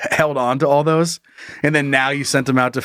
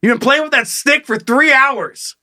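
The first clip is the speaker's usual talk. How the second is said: high, indignant mock scolding; exasperated yell, pitched way up; yelling dad; 11 semitones higher than usual. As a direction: holler at the kid like an annoyed dad. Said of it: "They're yelling at your dad".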